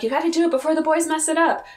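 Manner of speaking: high, quick mock urging; urgent retold warning. Host acts out adults rushing the photographer, mock urgency. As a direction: hurry them along, lightly acted.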